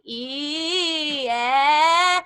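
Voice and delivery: the wrong, pushed vowel; falling back. Shown as a demonstration. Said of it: "was falling back".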